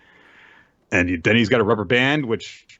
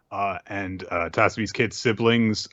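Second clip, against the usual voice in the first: ordinary talk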